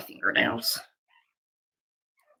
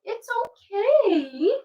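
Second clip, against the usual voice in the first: soothing; 10 semitones higher, much slower than usual. Real, not acted. Soothing crying puppy.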